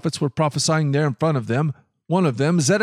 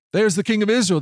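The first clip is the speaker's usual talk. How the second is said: raised pitch, little added volume; narrated cry of soldiers spotting their target; mild shout; 7 semitones higher, faster than usual. A narrator's reading. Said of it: "they shouted".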